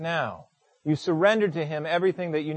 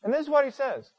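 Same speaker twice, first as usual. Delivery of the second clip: normal talking voice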